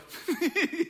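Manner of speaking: high, forced little laugh to cover not hearing; a nervous play-along chuckle; embarrassed laugh; awkward fake laugh. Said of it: "I just go".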